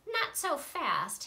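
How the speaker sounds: slow, stern, nasty teacher warning; a hint of a nasty witchy teacher; unpleasant hiss. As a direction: stop them cold, slowly. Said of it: "hissed an unpleasant voice".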